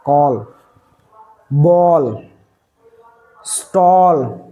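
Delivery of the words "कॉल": कॉल said with a rounded "aw", like call; correct "call". Shown as a demonstration. Teacher models कॉल with a rounded "aw". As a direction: model कॉल with an open "aw" sound. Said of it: "इस तरह प्रोनाउंसिएट करते हैं".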